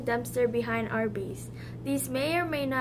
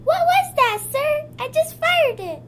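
high-pitched startled squeal; 12 semitones higher, 5 dB louder than usual. Acted. Henchman shrieks as weapon fires.

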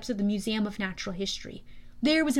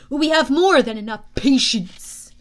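louder, commanding villain voice; deep, powerful general; 9 dB louder than usual. A narrator's reading. General answering Luke before the guards, powerful and commanding. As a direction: overrule him with booming authority. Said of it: "deeper and stronger".

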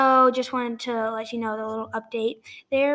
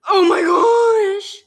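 shout; 8 semitones higher, 11 dB louder, much slower than usual. Real, not acted. Sudden pained shout.

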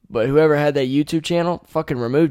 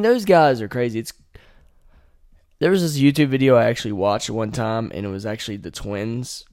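usual speaking voice

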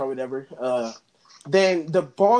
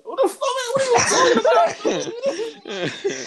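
shocked disbelief; 4 dB louder, slower than usual. Retold or imitated. Shocked reply to classmate.

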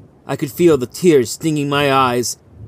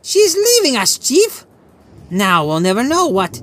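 much higher, light teasing voice; smiling Legal; 11 semitones higher than usual. A narrator's reading. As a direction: tease the chief with a grin. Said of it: "He smiled at Ochoa and went on".

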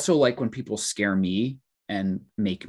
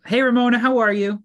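much higher, louder bright greeting; cheery greeting; 13 semitones higher, 6 dB louder than usual. Retold or imitated. Greeting coworker Ramona at work, cheery high hello.

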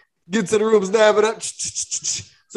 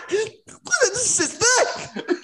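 high, slow words through laughter; laughing; 11 semitones higher, 4 dB quieter, much slower than usual. Real, not acted. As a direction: crack up while getting it out. Retelling, cracking up at gross sip.

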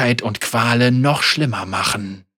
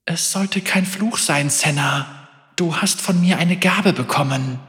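gentle spirit voice; 6 semitones higher than usual. Acted. A new gentle voice speaks.

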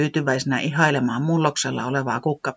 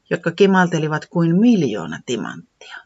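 ordinary delivery, nothing marked

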